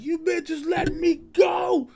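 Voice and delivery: high, slow strangled shout; squeaky hammed-up Kong shout; monster yelling. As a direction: scream it like a chained beast. Kong voiced yelling while chained.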